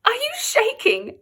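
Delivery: teasing, high and slow; mock your friend. A narrator's reading. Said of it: "Nat mocked his friend".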